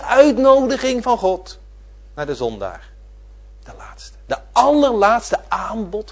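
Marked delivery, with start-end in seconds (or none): 3.6-4.2 unvoiced hushed whisper. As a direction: let it drop to a grave whisper. Stressing the last offer of grace.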